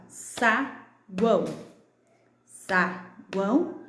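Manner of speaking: "saguão" split sa - guão; two slow beats, sa, then guão; syllable by syllable. Shown as a demonstration. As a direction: clap out "saguão" with a gap between syllables. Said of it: "aquelas que eu bati com vocês".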